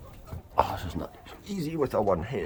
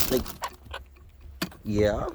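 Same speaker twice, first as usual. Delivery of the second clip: normal talking voice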